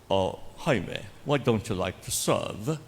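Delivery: slow, imitated British rector's English; British accent. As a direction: put on a drawn-out British accent. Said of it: "British siya. Ganito magsalita". (puntong Briton na ginagaya, mabagal)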